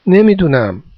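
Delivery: ordinary delivery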